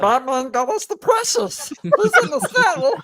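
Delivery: gleeful Gollum, laughing through it. Pretend. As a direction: Gollum voice gloating over Batman. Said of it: "more Gollum impressions".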